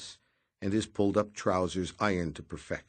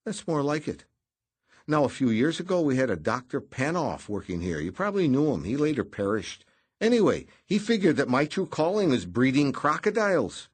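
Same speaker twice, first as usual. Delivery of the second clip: higher, brisk, breezy office man; voiced Noonan; 8 semitones higher, 7 dB louder than usual. A narrator's reading. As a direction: brush him off lightly.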